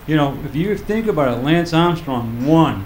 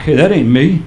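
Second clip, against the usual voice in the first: normal talking voice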